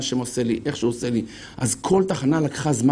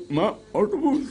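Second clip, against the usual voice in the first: high, laughing "what, a bus?"; mock surprise; 6 semitones higher than usual. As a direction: feign shock with a laugh. Mock astonishment at bus fare. (הפתעה מבודחת בקול גבוה)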